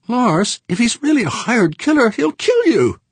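very high, loud, frantic woman; terrified plea. Acted. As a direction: beg in panic. She begs him, terrified.

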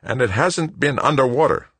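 high-pitched grumbling retort; muttered retort. A narrator's reading. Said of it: "Wojo muttered".

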